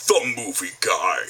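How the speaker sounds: slow, low movie-trailer announcer. Acted. Imitating Don the movie guy, slow trailer voice. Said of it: "imitate his voice".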